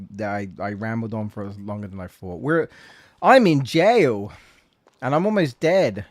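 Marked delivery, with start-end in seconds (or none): 2.4-6.0 loud, higher pleading prisoner; desperate cry for help. Acted. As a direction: beg to be let out, loudly. Trapped game character pleading.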